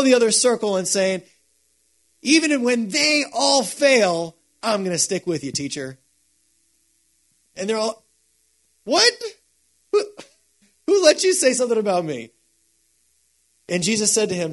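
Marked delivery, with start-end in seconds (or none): 2.2-6.0 mock boast. Mocking Peter's boast to the group. 8.8-12.3 indignant outburst. Retold or imitated. Disciples indignant at Peter.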